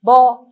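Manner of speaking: "Bol" loud, split into BO, pause, L; sounded out. Shown as a demonstration. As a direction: isolate the final L of "Bol". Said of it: "on entend aussi quelque chose d'autre".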